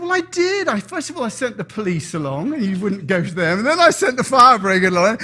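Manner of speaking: God explaining to the drowned man; patient God. Acted. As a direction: set him straight kindly. God answers the complaint.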